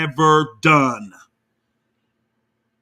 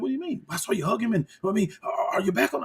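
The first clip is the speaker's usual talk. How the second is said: much higher and softer, like a secret aside; hushed retold question; 5 semitones higher, 7 dB quieter, faster than usual. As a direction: half-whisper it, pitched up and confidential. Hushed exchange retold, voiced.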